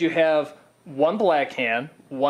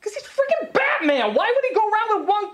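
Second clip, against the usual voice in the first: squeaky, much higher, exasperated; excited outburst. Real, not acted. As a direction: shoot way up in pitch, laughing at the end. Indignant rant about Batman's glove.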